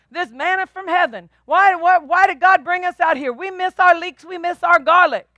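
mock the grumbling people in the desert; whining Israelites. Preacher mocks Israelites grumbling in the wilderness, whining.

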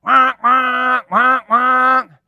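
playful car horn imitation for the congregation; imitated car horn. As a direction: honk it out like a station wagon horn. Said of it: "blowing the horn".

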